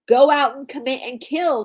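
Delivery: a hint of a commanding father's order; mildly voiced stern order. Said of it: "commands Father Sam".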